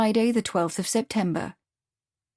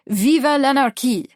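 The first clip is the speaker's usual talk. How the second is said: louder, higher exultant slogan; triumphant cry; 5 semitones higher, 5 dB louder than usual. A narrator's reading. Pierre after killing Tropov, triumphant cry. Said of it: "Pierre cried triumphantly".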